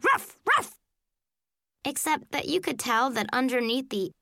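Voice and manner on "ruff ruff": high, yappy little dog barks; nervous barking. A narrator's reading. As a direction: bark like a nervous puppy. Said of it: "the nervous barking".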